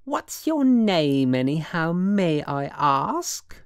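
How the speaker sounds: high, drawn-out mock-English accent. A narrator's reading. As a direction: affect a haughty English accent. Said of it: "getting an English accent".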